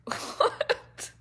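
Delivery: breathy startled what; mock shocked what. Reacting to people doing outlandish things, mock shock. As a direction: gasp out a stunned what. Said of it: "you're like".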